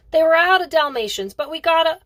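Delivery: put-on a boy yelling high and loud as he bursts in; young boy shouting his return at the top of his voice; excited shout. A narrator's reading. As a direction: call out over the racket, thrilled. Boy excitedly reports the dog.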